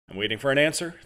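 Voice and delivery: a touch of impatience, pressing; mildly impatient, wants his answer now. Acted. Interrogator presses Adam for an answer, mildly impatient. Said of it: "the man said impatiently".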